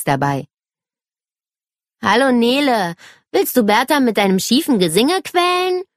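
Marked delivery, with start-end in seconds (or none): 2.0-5.8 higher-pitched boy mocking his sister; teasing brother. A narrator's reading. David to Nele about her crooked singing, teasing. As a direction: tease her, lifting the pitch into the question.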